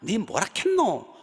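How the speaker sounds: put-on high, loud old Gyeongsang granny; Gyeongsang dialect. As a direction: mimic a Gyeongsang grandmother scolding. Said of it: "그걸 못 바꿔요".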